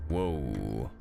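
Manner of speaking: usual speaking voice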